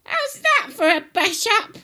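put-on very high, loud old-woman shriek; high-pitched grandma yell; shouting grandma. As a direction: holler it as a triumphant granny. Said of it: "she shouted".

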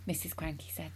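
ordinary delivery, nothing marked

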